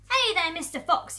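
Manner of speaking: high, loud hail; yelled taunt. Acted. Bunce leaning from his tractor to Mister Fox, yelling. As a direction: yell out to the quarry. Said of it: "yelled Bunce".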